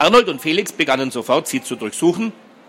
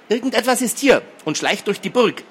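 high-pitched uneasy suspicion; suspicious; 9 semitones higher than usual. A narrator's reading. Girl sensing something creeping through the castle, suspicious. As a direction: voice raised in nervous distrust. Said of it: "argwöhnte Hedi".

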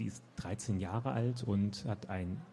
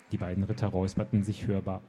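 put-on lively read-aloud narration for young readers; story narration; 5 dB louder than usual. A narrator's reading. Author reading her children's book to listeners. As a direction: tell the story with animated expression.

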